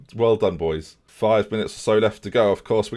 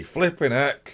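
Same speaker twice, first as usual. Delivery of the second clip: ordinary talk, nothing marked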